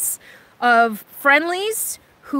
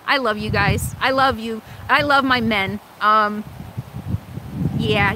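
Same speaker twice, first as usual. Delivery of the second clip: ordinary talk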